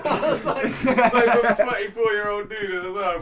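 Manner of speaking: loud, high, helpless laughter through the words; real laughter bursting into the story; laughing hard. Real, not acted. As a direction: tell it while cracking up.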